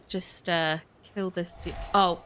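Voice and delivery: ordinary talk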